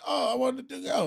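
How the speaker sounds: high, drawn-out imitation of someone left out; mock whine. People seeing an event they can't join, mock whining.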